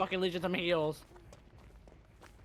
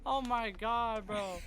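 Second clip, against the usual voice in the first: amazed disbelief; 6 semitones higher than usual. Real, not acted. Amazed at enemy power.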